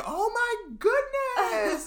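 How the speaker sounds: very high, slow squeal of delight; super happy. Retold or imitated. Teacher retelling her overjoyed reaction to her student, high slow squeal. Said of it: "I was super happy".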